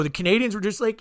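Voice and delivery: ordinary delivery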